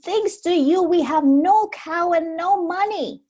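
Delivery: high, loud furious scolding; angry mother. Acted. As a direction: blame him at full volume. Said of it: "she shouted".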